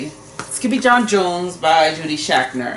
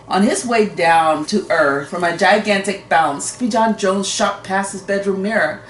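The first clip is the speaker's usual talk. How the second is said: ordinary delivery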